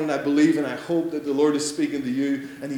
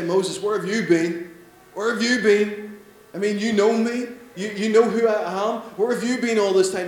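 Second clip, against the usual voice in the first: mock reproach; 6 semitones higher, 5 dB louder than usual. Imagined scolding God voice.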